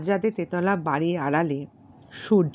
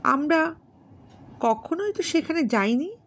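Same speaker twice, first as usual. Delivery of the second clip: much higher, astonished question; puzzled young woman, pitched up high; surprised; 11 semitones higher than usual. Acted. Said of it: "Bijoya আশ্চর্য হইয়া জিজ্ঞেস করিল".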